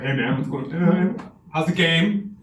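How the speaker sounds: lightly voiced dude at a game; straight guy voice.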